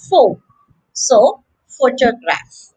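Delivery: "Pho" alone, then PHO-to-graph; "photograph" stressed on PHO; first-syllable stress. Shown as a demonstration. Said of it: "The stress is on the first syllable".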